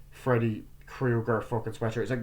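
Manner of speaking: ordinary talk, nothing marked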